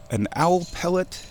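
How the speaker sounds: ordinary delivery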